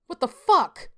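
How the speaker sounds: shocked demand. A narrator's reading. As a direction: blurt it out in pain and outrage. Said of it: "I demanded from the elderly woman".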